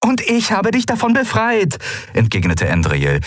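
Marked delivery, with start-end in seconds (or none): 0.0-1.8 high-pitched, insistent comeback; heated retort. A narrator's reading. Pilot releasing her bodyguard from his promise, heated. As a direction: retort firmly, voice lifted. Endriel argues with Keru.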